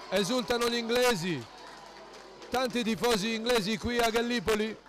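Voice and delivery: rising, rapid goal commentary; excited goal call. Real, not acted.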